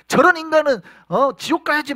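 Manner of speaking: high, loud furious denial; cursing Peter. Acted. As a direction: curse him out to save yourself. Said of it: "막 예수를 저주했단 말이에요". (예수를 저주하는 베드로의 높고 큰 분노)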